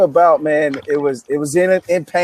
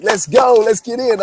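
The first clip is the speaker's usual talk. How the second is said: put-on excited kid; 7 semitones higher, 3 dB louder than usual. Excited cousin before soccer game.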